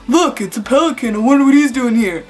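lightly hammed-up clueless wonder; mildly mocking amazed voice; mock idiot guy. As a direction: imitate a dumb guy just noticing something. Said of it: "Totally acting like an idiot".

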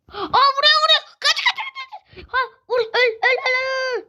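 high-pitched, panicked gamer cries; frantic excitement. Real, not acted. Gamer under fire urging the enemy to die, frantic. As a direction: yelp frantically in the heat of a firefight.

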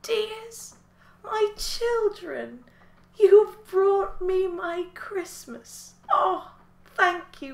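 put-on high, slow, tender old woman voice; old widow woman. Old widow thanks the animals for Christmas, tender. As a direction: thank them like a frail grandmother. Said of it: "a smile came over her face".

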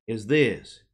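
ordinary talk

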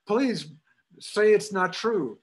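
high, imitated pleading with a laugh; mock pleading. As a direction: beg like a lovesick cousin. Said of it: "she said".

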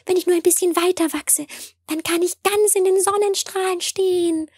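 a sprout breaking into the sunlight, delighted; tiny delicate voice. A narrator's reading. Tiny sprout emerging into the sun, high and whispery. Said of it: "ein zartes Stimmchen, das leise rief".

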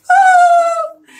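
very high, loud held "ooh" laugh; high-pitched whoop. Real, not acted. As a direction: let out a long high hoot of laughter. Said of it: "hear me laughing like that".

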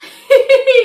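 loud, high-pitched laughing fit; burst of laughter. Real, not acted. Reactor cracking up at the Briefcase Joe story, loud laughter.

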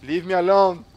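mock-panicked shout; loud plea. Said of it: "I'm scared".

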